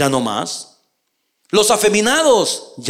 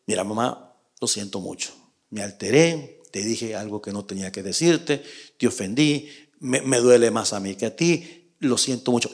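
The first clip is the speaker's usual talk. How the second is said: soft, much lower and quieter apology to his wife; tender apology; 10 semitones lower, 7 dB quieter than usual. Retold or imitated. Husband apologising softly to his wife, tender. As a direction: say sorry gently, almost under your breath. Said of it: "ir donde ella y decirle".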